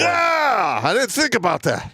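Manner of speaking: put-on loud high drunk Canadian accent.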